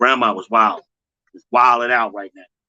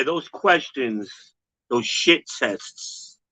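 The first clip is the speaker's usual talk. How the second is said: ordinary delivery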